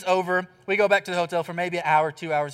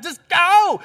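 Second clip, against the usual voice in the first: higher, louder, pleading him out; insistent mock plea; 10 semitones higher, 10 dB louder than usual. Husband urges wife to go without him, loudly. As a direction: push her to leave, hammed-up. Dramatic argument on plane.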